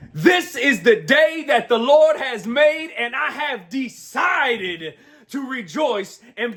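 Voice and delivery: slow, high proclamation with stretched words; emphatic declaration. Real, not acted. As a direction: proclaim it big, drawing out each stressed word. Declaims his daily scripture line.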